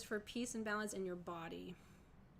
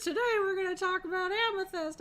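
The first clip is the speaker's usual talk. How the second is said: put-on high, loud children's TV presenter; kids show host voice; 12 semitones higher, 15 dB louder than usual. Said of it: "I would hope not to do that voice".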